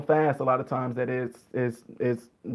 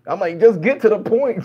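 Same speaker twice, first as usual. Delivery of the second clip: louder, higher mock impatience; exasperated; 4 semitones higher, 4 dB louder than usual. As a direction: beg them to get to the point, acting fed up. Impatient listener begging for the point.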